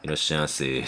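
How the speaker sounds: timid welcome trailing off to almost nothing; too shy to raise the voice; tiny voice. Retold or imitated. The chef greets customers in a tiny, timid voice. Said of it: "ちっちゃい声".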